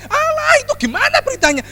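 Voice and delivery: high, quick gossipy fan voice. Retold or imitated. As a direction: chatter like a nosy celebrity fan. Mock gossiping about celebrity news. (suara tinggi cepat penggemar gosip)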